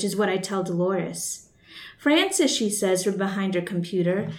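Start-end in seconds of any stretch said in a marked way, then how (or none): 2.0-2.5 name called out high and sharp; raised-pitch call of a coworker's name. Acted. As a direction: snap her name to get her attention. Coworker calling her name sharply.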